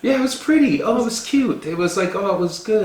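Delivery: high, sing-song mock compliments; soft polite praise. Host mimicking unimpressed guests after a wedding, mock polite praise. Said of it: "They use really soft words".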